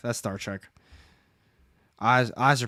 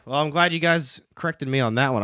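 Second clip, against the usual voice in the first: ordinary talk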